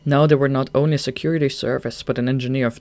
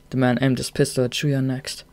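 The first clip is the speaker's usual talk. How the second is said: usual speaking voice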